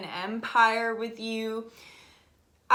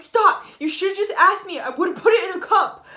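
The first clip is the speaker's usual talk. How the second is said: much higher, much louder pleading yell; yelling stop; 8 semitones higher, 10 dB louder than usual. Retold or imitated. Yelling at someone about to kill a spider, loud pleading shout. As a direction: yell to save the spider, half laughing. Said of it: "I yell at everyone who kills a spider".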